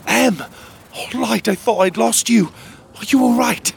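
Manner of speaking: high relieved cry; relieved excitement. Acted. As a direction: rush to her in relief. Relieved reunion with friend.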